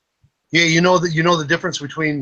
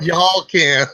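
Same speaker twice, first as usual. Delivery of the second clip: higher Southern drawl, played up; Southern girl accent; 4 semitones higher, 4 dB louder than usual. Shown as a demonstration. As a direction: repeat the Southern punchline with relish. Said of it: "Southern girls say".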